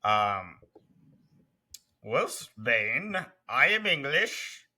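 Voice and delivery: slow, pompous fake English accent; lightly put-on English gent; hammy English accent. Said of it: "I'm like".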